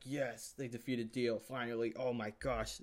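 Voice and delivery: drawn-out, lightly acted cheer; retold relief and triumph, stretching the words; mock cheer. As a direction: celebrate like a fan reliving the win. Acts out own cheering reaction.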